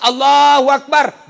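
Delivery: long, slow, loud chanted takbir; takbir demo. Shown as a demonstration. Preacher demonstrates the funeral prayer to the congregation, slow loud chant. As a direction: call it out slowly like an imam leading prayer. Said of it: "Kursus sholat jenazah".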